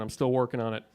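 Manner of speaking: normal talking voice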